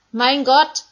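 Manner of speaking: Jesus screaming to God from the cross. Acted. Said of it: "Da hören sie Jesus schreien".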